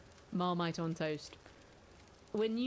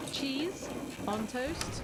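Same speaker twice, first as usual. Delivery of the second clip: slow announcement; 6 semitones higher, much slower than usual. Acted. Announcing a nominee.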